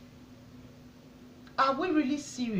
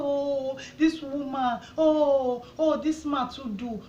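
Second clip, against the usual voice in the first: higher, drawn-out cries of "oh"; mock communal lament, pitched up; imitated public outcry; 3 semitones higher, 3 dB louder, slower than usual. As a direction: mimic a crowd wailing in protest. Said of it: "Everybody dey shout now".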